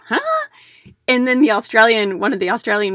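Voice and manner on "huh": baffled huh. Retold or imitated. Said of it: "her face was like".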